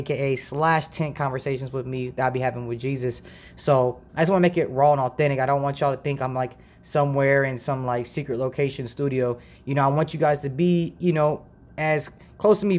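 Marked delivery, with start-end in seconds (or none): none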